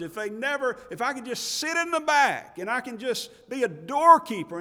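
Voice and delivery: loud, high pulpit preaching; rising fervour from the pulpit; preaching at full force. Acted. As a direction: preach it out to the back pews. Preacher raising voice to congregation.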